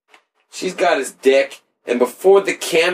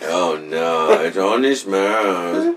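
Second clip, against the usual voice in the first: put-on low, slow jokey German accent; mock German accent; 7 semitones lower, much slower than usual. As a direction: drop low and drag it in a fake German accent. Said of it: "That was, like, German".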